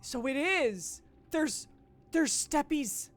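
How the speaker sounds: loud, high-pitched delight; excited discovery. Real, not acted. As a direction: exclaim it with real excitement. Discovers hidden steps in the game.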